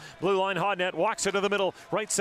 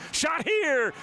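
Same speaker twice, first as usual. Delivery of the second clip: sudden shout; 7 semitones higher than usual. Real, not acted. Commentator calling a shot on goal, sudden shout. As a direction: punch out the call. Shot during power play pressure.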